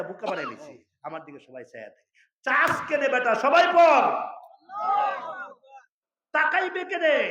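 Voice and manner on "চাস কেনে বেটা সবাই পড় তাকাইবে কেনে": sudden furious yell, much higher and louder; angry shout. Retold or imitated. Preacher yelling at people staring at him in the lift, furious shout. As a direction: bark at the starers like a scolding elder.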